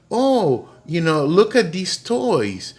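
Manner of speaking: high, drawn-out wide-eyed reply; innocent child. Retold or imitated. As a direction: imitate a little boy showing off a find. Boy answers mom innocently.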